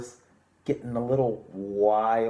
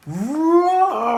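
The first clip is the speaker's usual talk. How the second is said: a gasp, then a much higher, much louder roar; big tiger roar; 11 dB louder than usual. Pretend. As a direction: let loose a wild, full-throated tiger roar for the kids. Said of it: "On three".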